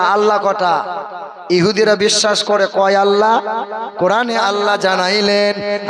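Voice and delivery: ordinary delivery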